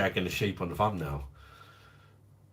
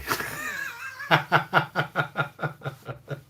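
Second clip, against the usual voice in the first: real laugh that starts high and tumbles down; high cackling laugh. Real, not acted. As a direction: burst into a high, falling cackle. Laughs at own beer joke.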